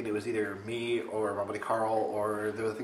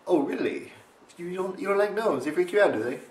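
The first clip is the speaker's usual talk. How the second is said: high taunting fake surprise; sly teasing; 8 semitones higher than usual. Teasing his friend about her gnome fear, sly and high. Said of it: "I was like".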